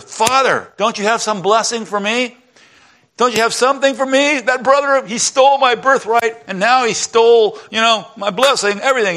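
high-pitched, aggrieved outburst; mad. Acted. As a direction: protest to your father, pitch soaring in indignation. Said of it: "And Esau gets mad".

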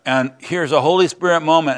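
ordinary delivery

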